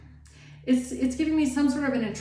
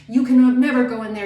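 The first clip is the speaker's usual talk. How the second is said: mock scolding; 3 semitones higher, 10 dB louder, faster than usual. Strict parent scolding the child.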